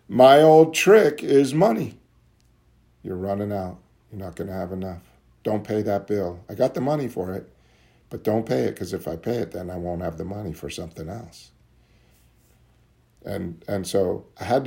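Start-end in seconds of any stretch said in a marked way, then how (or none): 3.0-11.5 soft, insidious inner voice of the disease. Retold or imitated. Addict's disease tempting him to skip the bill, softly. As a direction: talk low like the addiction whispering doubts. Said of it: "My disease uses the old trick".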